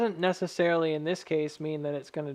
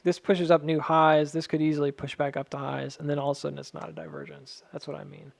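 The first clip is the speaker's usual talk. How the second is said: ordinary talk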